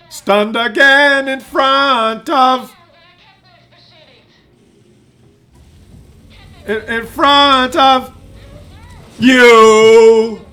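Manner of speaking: slow, rising to a loud yell; mock frustration building. The stunned tank builds to a loud exasperated yell. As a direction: drag it out then blow up on you.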